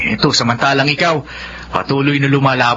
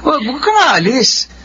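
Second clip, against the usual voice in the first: pleading; 11 semitones higher than usual. Acted. Pleading friend not to leave.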